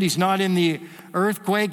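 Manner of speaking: ordinary talk, nothing marked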